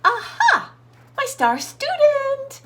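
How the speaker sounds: high, chirpy little bird voice; little bird greeting her star student, high and sing-song. Acted. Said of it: "she sang".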